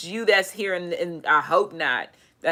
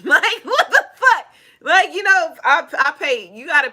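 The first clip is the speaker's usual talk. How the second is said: high, loud laughing disbelief; 8 semitones higher, 5 dB louder than usual. Real, not acted. Laughing at the girl's strangeness.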